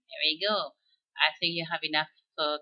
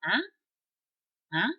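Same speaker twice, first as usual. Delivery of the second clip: French huh; 5 semitones higher, 5 dB louder than usual. Shown as a demonstration. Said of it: "And you say".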